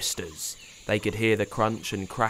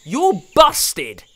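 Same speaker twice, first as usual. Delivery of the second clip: high, loud triumphant yell; boy yelling gleefully; 9 semitones higher, 10 dB louder, much slower than usual. A narrator's reading. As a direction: call her out triumphantly. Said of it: "yelled Pablo".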